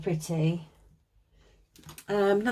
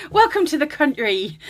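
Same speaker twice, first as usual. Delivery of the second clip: hammed-up West Country twang, higher and louder; mock West Country welcome, pitched up; bad West Country accent; 7 semitones higher, 5 dB louder than usual. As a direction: do a silly, badly faked West Country accent. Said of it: "That's the worst accent ever".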